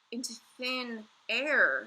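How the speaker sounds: slow, loud correction; Sophie correcting. A narrator's reading. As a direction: correct him firmly. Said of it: "Sophie said".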